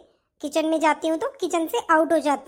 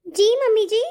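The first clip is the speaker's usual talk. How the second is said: usual speaking voice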